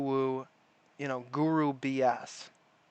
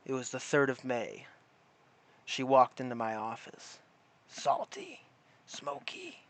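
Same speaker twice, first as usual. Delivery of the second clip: noir detective; 4 semitones lower than usual. Pretend. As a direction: brood like a tired gumshoe. Mocking a noir detective monologue.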